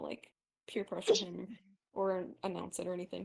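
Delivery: ordinary talk, nothing marked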